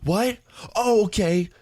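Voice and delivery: very high imitation of her outrage; hammed-up indignant comeback, pitched right up; mock irate. Irate woman snapping back at Jake, mock outrage. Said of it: "she's, like, becoming irate".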